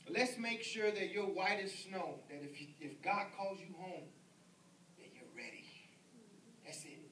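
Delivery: hushed reassurance at a deathbed; tender hush. Retold or imitated. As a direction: lean in close and comfort him gently. Comforting a dying man.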